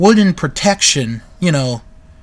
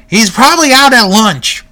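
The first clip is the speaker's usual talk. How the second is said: louder, higher announcer's retort; Mean Gene; 6 semitones higher, 7 dB louder than usual. Retold or imitated. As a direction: quote the announcer firing back, voice raised. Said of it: "Mean Gene's like".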